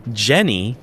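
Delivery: ordinary talk, nothing marked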